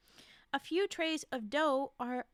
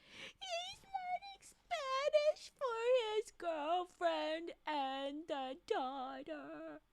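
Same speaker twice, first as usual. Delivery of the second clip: much higher, slow, teary-sounding gush; high slow adoring coo; 4 semitones higher, much slower than usual. Real, not acted. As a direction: melt over how sweet he is. Gushing over Rick's sweetness.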